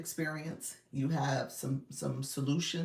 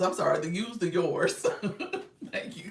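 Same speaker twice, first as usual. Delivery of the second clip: ordinary talk, nothing marked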